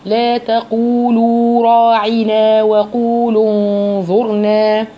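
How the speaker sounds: slow chanted recitation with full madds; Quran recitation. Shown as a demonstration. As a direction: recite it, giving each long vowel its time. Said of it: "لازم تديها وقتها".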